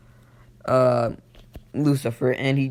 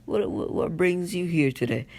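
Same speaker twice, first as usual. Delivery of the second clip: higher, softer halting stammer; nervous stutter; 4 semitones higher, 3 dB quieter than usual. Retold or imitated. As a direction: imitate someone stumbling nervously over the words. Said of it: "You know, stuttering".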